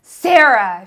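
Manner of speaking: loud furious yell of a name; screaming manager. Retold or imitated. Angry manager screaming at new cashier in front of co-workers. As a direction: scream her name across the room. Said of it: "she screamed at me".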